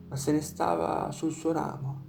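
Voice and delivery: ordinary delivery, nothing marked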